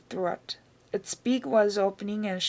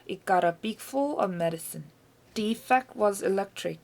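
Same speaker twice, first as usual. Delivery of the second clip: ordinary delivery, nothing marked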